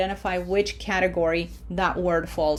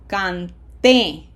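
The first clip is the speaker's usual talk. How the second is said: Canté with TÉ punched louder; stress on last syllable; 6 dB louder than usual. Shown as a demonstration. Teacher modelling for students, Canté stressed on TÉ. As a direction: hit the final TÉ of Canté. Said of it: "red syllable is what has pronunciation".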